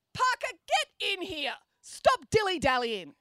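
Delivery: high, loud, slow angry snap; snapping teacher. A narrator's reading. Teacher snaps at the loitering student, loud and high. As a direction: bark him into the room. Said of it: "he snapped".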